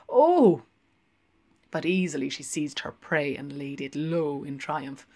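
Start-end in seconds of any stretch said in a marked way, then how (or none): 0.1-0.6 loud rising gasps; gasping oh. A narrator's reading. Linehan gasping as the barmaid stretches, loud gasps. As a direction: gasp at each stretch. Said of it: "gasping at each stretch".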